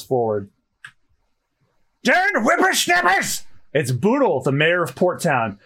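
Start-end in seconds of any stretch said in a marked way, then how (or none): 2.0-3.4 put-on very high, slow old man's cry; high, drawn-out geezer outburst; cranky old-timer. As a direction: scold the youngsters like a crotchety elder. Old mayor enters scolding.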